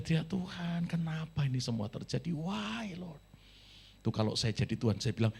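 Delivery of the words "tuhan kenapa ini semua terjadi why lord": a soft, teary plea to God, voice dropping away; tearful prayer. Retold or imitated. Student alone in Japan crying out to God, softly tearful. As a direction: pray quietly as if through tears. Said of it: "Sambil nangis dia".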